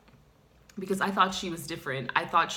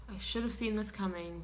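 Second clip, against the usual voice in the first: ordinary talk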